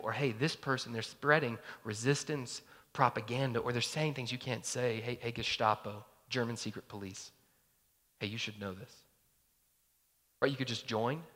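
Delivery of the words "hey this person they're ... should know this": low, soft informer's voice; hushed informing. Acted. Informer reporting a neighbour to the Gestapo, hushed. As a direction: tip them off quietly.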